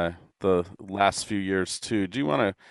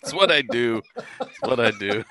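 laughing admission; 3 semitones higher than usual. Real, not acted. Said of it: "I can't help it sometimes".

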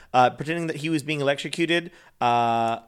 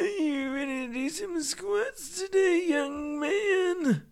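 put-on high, slow, softer old-timer; put-on elderly voice; old man voice; 5 dB quieter, slower than usual. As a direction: coach like a doddering old man. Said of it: "That's how you gotta talk to the people".

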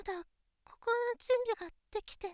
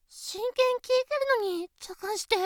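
normal talking voice

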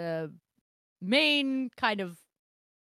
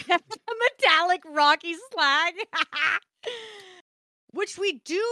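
laughing; 7 semitones higher, 5 dB louder than usual. Real, not acted. Laughing at slag joke.

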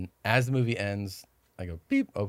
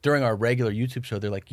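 ordinary delivery

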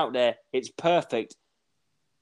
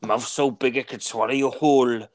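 put-on Quint's odd accent; 4 semitones lower than usual. Shark hunter silencing the town hall, low and ominous Quint impression. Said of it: "what was his accent".

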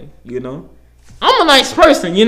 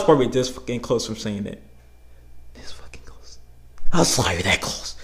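hushed aside; 10 semitones lower, 9 dB quieter than usual. Real, not acted. Confiding how near he came.

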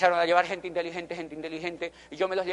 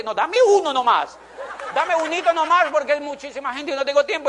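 laughing quote; 7 semitones higher, 6 dB louder than usual. Retold or imitated.

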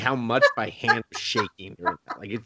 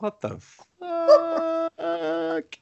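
much higher, drawn-out shocked cry; mock shocked; much slower than usual. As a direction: stretch out the shock comically. Death reacts, comic.

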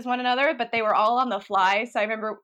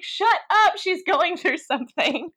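higher, louder mock-scolding shout, easing off with drawn-out words; facetious yell; 7 semitones higher, 5 dB louder, slower than usual. Telling off the laughing roommate during a movie, facetious yell. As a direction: playfully yell it, imitating your own outburst. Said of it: "I yelled, like, really loudly".

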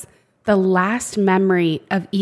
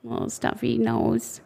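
sympathetic coo; 7 dB quieter, slower than usual. Retold or imitated. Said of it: "I'm like".

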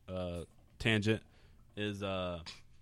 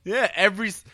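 high, whining gripe, louder; whiny high complaint; 13 semitones higher, 9 dB louder than usual. Real, not acted. Venting to friends that she keeps flaking, in a whiny, high, louder gripe. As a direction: squeal it like a wounded complaint.

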